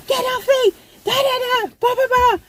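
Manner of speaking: high frantic yelling; retold panicked shrieking; freaking out. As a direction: yell in panic, high-pitched. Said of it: "I'm there freaking out".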